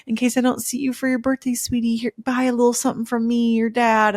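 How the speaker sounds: a hint of a gentle, doting father; sweet dad. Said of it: "and was like".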